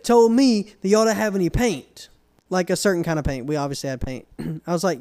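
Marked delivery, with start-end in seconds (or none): 0.0-2.1 higher-pitched imitated customer. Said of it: "He's like".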